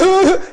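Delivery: loud, high mock sobbing; imitated weeping. Preacher showing how the children wept, imitated weeping. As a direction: burst into exaggerated boo-hoo sobs. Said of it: "They all started weeping".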